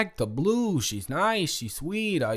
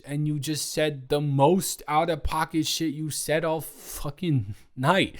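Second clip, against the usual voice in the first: usual speaking voice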